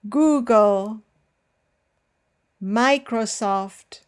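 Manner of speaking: FACEbook, TWITter, GOOgle, MIcrosoft, KINdle, APple, Ipad, each stressed up front; careful listen-and-repeat words, first syllable leading. Shown as a demonstration. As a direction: hit the first syllable of each name, leaving gaps to repeat. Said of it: "first syllable is the one that bears the stress".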